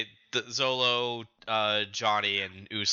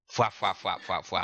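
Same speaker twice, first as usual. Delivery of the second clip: whispered flapping; 8 semitones lower, 3 dB quieter than usual. Acted. Whispered sound effect.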